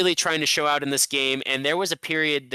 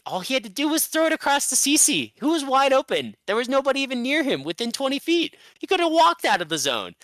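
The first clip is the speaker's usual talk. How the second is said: exasperated; 11 semitones higher than usual. Real, not acted. Frustrated rant about open pass.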